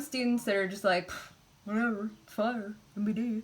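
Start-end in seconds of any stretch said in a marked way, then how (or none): 1.1-3.4 imitating a student shrugging it off; dismissive puff, then a careless mumble of indifference; mock indifference. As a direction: act unimpressed. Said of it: "like, indifference".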